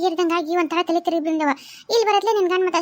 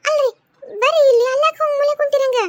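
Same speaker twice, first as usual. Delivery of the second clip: louder, higher call; 5 semitones higher, 5 dB louder than usual. Acted. Wife calls husband out of the corner, louder. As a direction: call him over across the room.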